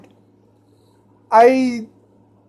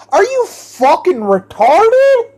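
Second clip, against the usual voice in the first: louder, higher, angry question; exasperated outburst; 8 semitones higher, 4 dB louder than usual. Real, not acted. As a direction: blurt it out in disbelief. Ranting at the officer.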